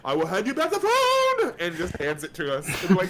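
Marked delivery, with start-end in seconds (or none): 0.0-1.5 high, loud cartoon beaver voice; Daggett. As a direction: shout it as a manic cartoon critter, pitch climbing. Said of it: "he's just talking as Daggett".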